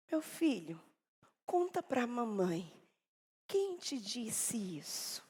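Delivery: soft, slow questioning; patient mother. Retold or imitated. Mother crouching, gently asking her son. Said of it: "veio com toda a paciência".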